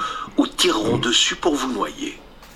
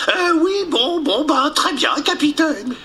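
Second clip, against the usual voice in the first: high hesitant bumbling sailor; flustered sailor voice; 14 semitones higher than usual. Acted. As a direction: stammer out a confused yes. Mouche flustered obeys.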